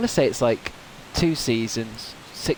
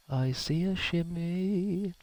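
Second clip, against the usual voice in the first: high, slow, drawn-out singsong; slow high drawl; 7 semitones higher, 6 dB quieter, much slower than usual. Pretend. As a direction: stretch it out up high. Spots a shimmy, repeats it.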